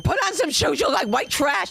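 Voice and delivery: high, loud scolding aunt. Retold or imitated. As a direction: snap at the kid like a stern aunt. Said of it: "my aunt saying".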